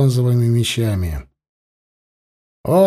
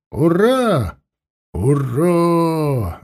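cheering roar; 3 semitones higher, much slower than usual. Acted. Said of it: "заревел строй".

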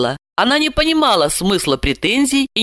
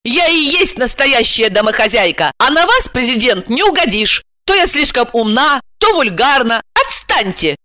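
higher-pitched, exasperated retort; angry bark; 6 semitones higher, 3 dB louder than usual. A narrator's reading. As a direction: snap back and end the conversation. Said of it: "рявкнула Ася". (рявкает раздражённо)

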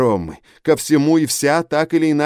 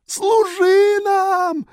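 very high, slow, drawn-out shriek; piercing scream; 4 dB louder, much slower than usual. A narrator's reading. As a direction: scream it out in ecstatic frenzy. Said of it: "пронзительно вопил он".

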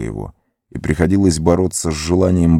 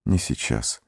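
very soft, lower, weary whisper; 6 semitones lower, 9 dB quieter than usual. A narrator's reading. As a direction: breathe it out, drained. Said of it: "прошептал он".

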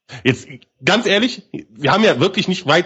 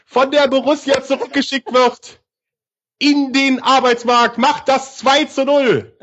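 gloating commentary; 6 semitones higher than usual. Real, not acted. Gloating goal call.

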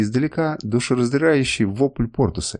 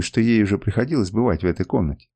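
ordinary delivery

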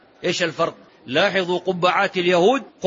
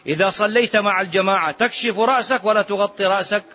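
normal talking voice

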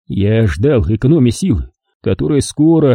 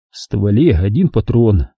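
normal talking voice

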